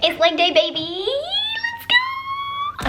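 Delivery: wired and pumped for leg day; too much caffeine. Real, not acted. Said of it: "Can you tell the caffeine has kicked in?".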